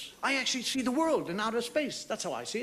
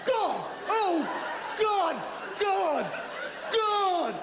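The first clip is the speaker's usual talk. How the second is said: hammed-up ecstatic calling to God; mock ecstatic God cries; 10 semitones higher, much slower than usual. Mocking lovers crying out to God in hammed-up ecstasy.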